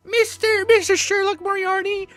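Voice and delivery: put-on very high, squeaky little-kid voice; excited child. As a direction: pipe up like an eager child questioning a hero. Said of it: "Her fucking children. Remember".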